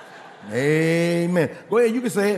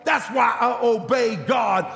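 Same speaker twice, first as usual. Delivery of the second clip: preaching at full force; 5 semitones higher than usual. Acted. Pastor retelling the elder's lesson to his congregation, full-force preaching. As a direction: build it up like the pulpit climax.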